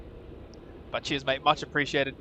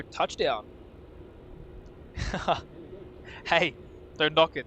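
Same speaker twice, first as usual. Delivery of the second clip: ordinary talk, nothing marked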